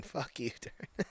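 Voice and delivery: laughing while cursing at a friend. Real, not acted. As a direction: chuckle through the insult. Laughing at a chat comment.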